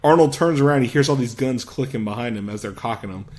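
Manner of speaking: ordinary talk, nothing marked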